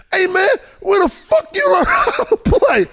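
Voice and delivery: high, loud, slow yelling; angry shout. Retold or imitated. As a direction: chew out your card partner. Oakley cursing him out.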